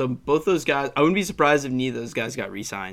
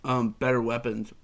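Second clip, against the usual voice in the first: normal talking voice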